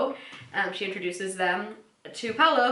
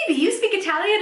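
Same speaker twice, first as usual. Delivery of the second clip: much higher, louder amazed question; surprised Joey; 11 semitones higher, 5 dB louder, faster than usual. Retold or imitated. Host voicing Joey amazed at Phoebe's Italian, much higher. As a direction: sound stunned and loud. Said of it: "And Joey's like".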